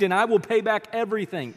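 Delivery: begging. A narrator's reading. Servant promising the king repayment, begging mildly. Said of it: "he begged".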